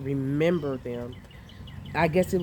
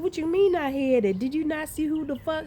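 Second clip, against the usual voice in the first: incredulous; 11 semitones higher than usual. Retold or imitated. Arguing with husband, retold.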